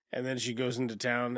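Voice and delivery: ordinary delivery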